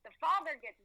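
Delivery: ordinary delivery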